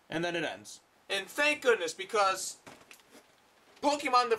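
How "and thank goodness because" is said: normal talking voice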